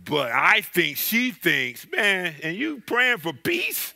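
gossiping church lady. Retold or imitated. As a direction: lean in like you're passing on gossip. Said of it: "I heard she said".

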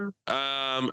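ordinary delivery

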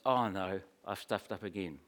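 low, soft dismay; a lightly voiced Peter, crushed; dismayed groan. Peter hearing the cock crow, quietly dismayed.

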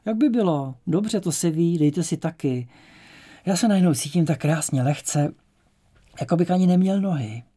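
high, loud euphoric outburst; elated. A narrator's reading. Myška feels wonderful after the mushroom and urges the others, elated. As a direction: let the voice soar with sudden lightness.

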